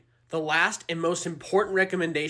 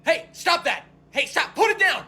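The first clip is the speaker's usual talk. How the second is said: high, sharp commands; urgent scolding; 6 semitones higher than usual. Acted. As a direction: order them to stop at once. Players frantically stop another player.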